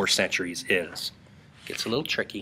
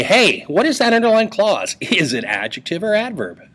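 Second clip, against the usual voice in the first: mock question; 9 semitones higher, 4 dB louder than usual. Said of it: "is say".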